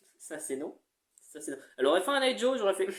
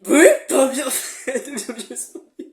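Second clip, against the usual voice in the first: laughing; 4 semitones higher, 9 dB louder than usual. Real, not acted. Laughing at own attempt.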